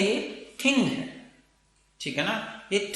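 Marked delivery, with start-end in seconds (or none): 0.0-1.3 थिंग said very slowly, ending on a clear ng; thing, not thin. Shown as a demonstration. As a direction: draw out थिंग so the ng is heard. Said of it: "थिन नहीं है".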